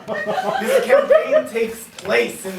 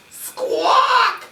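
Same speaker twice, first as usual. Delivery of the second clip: high, loud screeching parrot squawk; 5 dB louder than usual. Acted. Game master keeps interrupting with a shrill parrot squawk. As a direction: shriek it like a cranky parrot.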